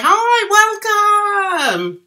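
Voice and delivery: high, drawn-out greeting; effusive high-pitched hello; big welcome. Real, not acted. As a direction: call out a welcome to everyone. Welcoming viewers to the stream.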